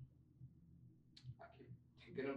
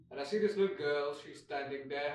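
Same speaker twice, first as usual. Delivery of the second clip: ordinary talk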